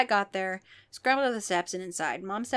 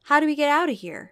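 high, loud, fast panic; panicked; 6 semitones higher, 7 dB louder, faster than usual. A narrator's reading. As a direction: blurt it out in panic. Luke panicking.

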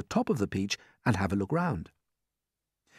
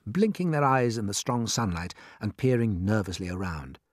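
plain reading voice

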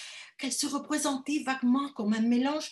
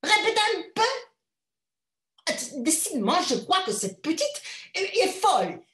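higher, louder stunned outburst; shocked cry; 6 semitones higher, 3 dB louder than usual. A narrator's reading. Stunned mother cries out at her daughter's request, loud. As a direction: cry out in disbelief. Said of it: "cria madame Cam, stupéfaite".

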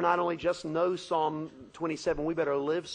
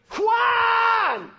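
loud high clanging cry; sword clash cry; 9 dB louder than usual. Acted. Preacher voicing the giant's sword strike, a clanging cry. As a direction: make the clang of the blade.

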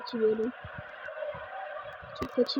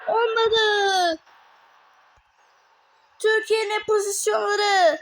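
lively match play-by-play, raised pitch; football commentator; 9 semitones higher, 7 dB louder, slower than usual. Acted.

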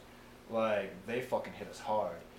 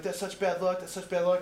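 high, loud fretful scolding; mock superstitious dismay; 8 semitones higher, 6 dB louder than usual. Comrades scold men sleeping in body bags, mock dismay. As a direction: fret over it in a raised, higher voice.

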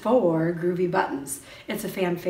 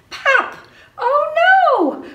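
much higher, louder playful alarm; mock surprise; 12 dB louder, much slower than usual. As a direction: gasp it out for the kids, big and high. Button pops off in story.

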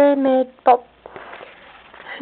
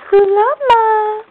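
higher, bright call to the animal; calling out the llama's name; 8 semitones higher than usual. Real, not acted. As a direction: call the llama over, lifted up. Calls out to the llama.

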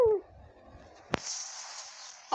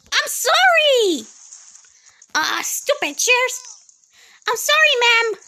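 loud apology; 6 dB louder than usual. Real, not acted. Player knocks over chairs, shouts a loud apology.

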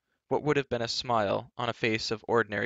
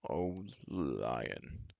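low, soft, drawn-out giant's lament; cried lament; 6 semitones lower, 8 dB quieter than usual. A narrator's reading. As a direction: lament the name low and long. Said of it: "cried Glue".

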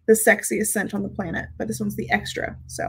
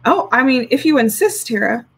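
normal talking voice

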